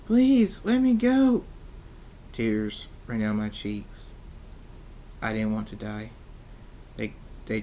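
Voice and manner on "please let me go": high, loud desperate scream; shrill-pitched cry for mercy at full volume; screamed plea. A narrator's reading. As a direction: scream it out, begging to be released. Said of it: "Cult Thunderstorm screams".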